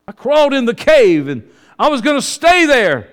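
loud, high cry of the confession; preaching outburst. Acted. Preacher confesses his stubborn retreat, loud and high. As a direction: throw the words up in a sudden burst.

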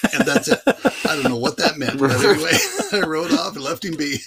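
loud, high laughing talk. Real, not acted. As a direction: laugh while telling it. Laughing at the punchline.

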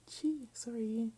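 slow, drawn-out mock apology; sarcastic gee sorry. Real, not acted. Reader mocking the book's wording: slow sarcastic sorry.

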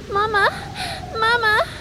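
put-on high, tearful little child calling; crying child. Lost child calling for mama through the rain. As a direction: whimper for your mother like a lost kid. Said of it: "a voice say".

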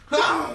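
loud, high comic yell; sudden exaggerated outburst, laughing; mock scream. Joking about a Snickers smack, mock scream.

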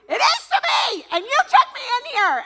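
very high, loud panicked shout back; panicked yell at sister. Retold or imitated. Snapping at her sister who dragged her in, panicked yell. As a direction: yell back, scared and accusing. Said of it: "And I'm like".

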